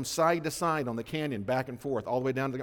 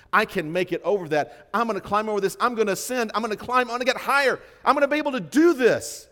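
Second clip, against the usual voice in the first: rising higher and louder with each line; building triumph; 7 semitones higher, 8 dB louder than usual. Retold or imitated. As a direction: climb in confidence to a peak. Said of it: "looks at those obstacles and says".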